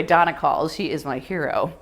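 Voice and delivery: usual speaking voice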